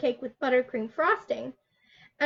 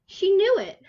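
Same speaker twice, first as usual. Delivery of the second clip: higher and louder finish; triumphant; 7 semitones higher, 6 dB louder than usual. A narrator's reading. Narrator ending the story for the children, higher and louder. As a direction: land the happy ending.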